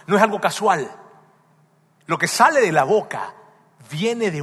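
loud, rising preacher's fervor; fervent preaching. Acted. Pastor urging his congregation, loud preaching. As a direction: drive the point home to the church.

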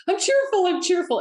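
high, bright forced cheer through tears; sing-song self-pep talk while crying; forced cheerful. Retold or imitated. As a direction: chant cheerful at a high pitch to hold back crying. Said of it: "And I would think".

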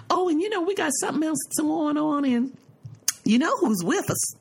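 high, teasing mother voice; lightly hammed-up chatty mother; imitating mother. Daughter mimics her mother's repeated teasing line, high and sing-song. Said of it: "she said".

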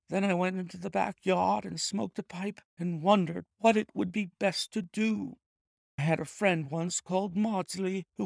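cowed clerk owning up; frightened thief confessing. A narrator's reading. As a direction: confess fast before he changes his mind. Ryder narrates his confession.

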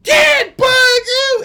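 high blood-curdling yell; screaming for dad. Retold or imitated. Kids screaming for dad over a bug. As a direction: shriek for help.

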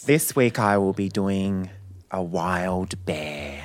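slow, low showman's build-up; mock-solemn announcement. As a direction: announce it slowly and deeply, with weight. Solemnly announces his animal act.

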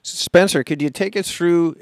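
ordinary talk

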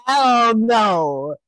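high, loud hell no; outraged shout. Real, not acted. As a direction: shout it in disbelief. Outraged at friend's top pick.

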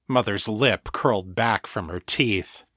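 ordinary delivery, nothing marked

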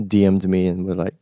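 usual speaking voice

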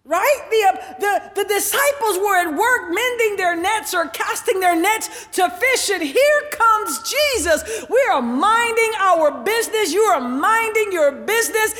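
high, emphatic sermon delivery; preaching. Real, not acted. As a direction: preach it out with stretched key words. Preaches at raised pitch.

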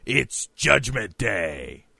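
slow, drawn-out fight-promo voice; mock-epic wrestling promo; wrestling match announcer. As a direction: announce it like a big wrestling bout. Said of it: "kinda like a wrestling match".

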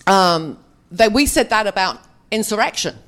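normal talking voice

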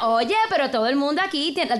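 a lighter Puerto Rican take on the line; Puerto Rican accent. Retold or imitated. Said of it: "that's a very different".

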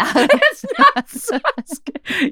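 high, drawn-out wail through laughter; laughing protest. Real, not acted. Co-host correcting satske mispronunciation, laughing protest. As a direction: squeal the correction slowly while cracking up.

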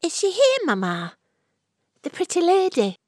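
put-on high, eager little boy asking for the pretty lady; young Sebastian, high and eager; child's voice. A narrator's reading. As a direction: voice the simple lad, high and hopeful. Boy Sebastian asks for Florrie.

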